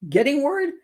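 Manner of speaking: Getting worried? thrown back in disbelief, pitch jumping up; incredulous echo. Real, not acted. Reacting to the fiance's claim, incredulous rising echo. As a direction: scoff at the word worried. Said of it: "took you an hour to get worried?".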